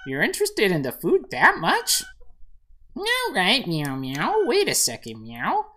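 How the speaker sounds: high kitty voice with swooping meows; talking cat. Acted. Cat agreeing to help the player, high and meowy. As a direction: play a chatty little cat, pitch jumping up. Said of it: "The cat says".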